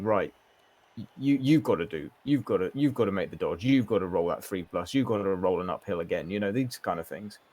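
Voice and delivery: ordinary talk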